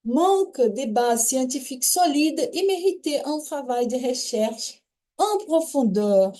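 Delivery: careful French reading voice; reading French text aloud. Shown as a demonstration. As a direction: read the French passage clearly to the class. (leitura em voz alta do texto francês)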